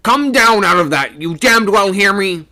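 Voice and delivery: loud, high shouted command; angry shouting. A narrator's reading. Skipper shouting at a man who won't answer, furiously. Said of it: "he was shouting again".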